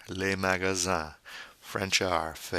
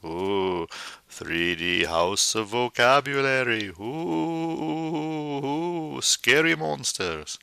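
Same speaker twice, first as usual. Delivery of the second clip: high, hammed-up ghostly ooh; mock spooky ooing; 6 semitones higher than usual. Teacher mocks the 3D house of vocabulary, hammed-up spooky. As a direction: play at scaring the class, high and eerie.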